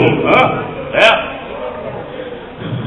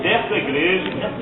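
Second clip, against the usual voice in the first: soft prayer lead; 12 dB quieter than usual. Real, not acted. Pastor leading new convert in quiet prayer. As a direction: guide her gently into prayer. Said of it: "leads the woman's prayer".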